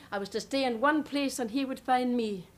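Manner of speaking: ordinary talk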